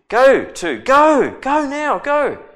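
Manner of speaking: high, loud, hurried orders; urgent king. Acted. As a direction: shoo him out the door, loud and high. King urgently sends Naaman off.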